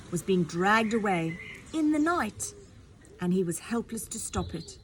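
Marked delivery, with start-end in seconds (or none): none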